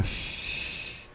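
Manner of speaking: "X" as a voiceless sh after A; soft hissed "X"; sh sound. Shown as a demonstration. Teacher blends the sounds A and X. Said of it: "vou juntando os sons".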